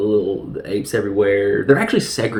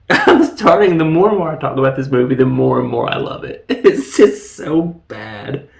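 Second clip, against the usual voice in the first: louder, laughing fondly at a bad film; 6 dB louder than usual. Real, not acted. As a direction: gush through laughter. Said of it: "laughing at the bad movie".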